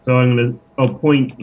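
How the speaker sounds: ordinary delivery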